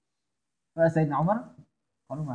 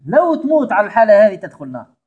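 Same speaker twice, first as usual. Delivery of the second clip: لو تموت said loud, the whole line louder; stress on the condition; 4 semitones higher, 9 dB louder than usual. Shown as a demonstration. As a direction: punch the "if you die" clause. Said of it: "قل له".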